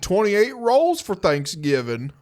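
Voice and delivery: high, loud, slow goofy voice. Retold or imitated. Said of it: "I was like".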